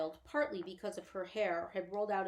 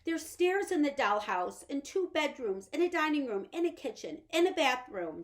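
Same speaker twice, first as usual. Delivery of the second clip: high, loud, pleading little woman; Homily pleading with Pod for the dollhouse; anxious little mother; 8 semitones higher, 9 dB louder than usual. Acted. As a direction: beg her husband, voice pitched up high.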